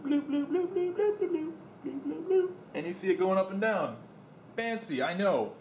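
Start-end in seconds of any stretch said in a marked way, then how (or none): 0.0-2.5 high, playful bloops; bloop sounds. Shown as a demonstration. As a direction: pop out high little bloops. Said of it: "I'm gonna tap on this mic".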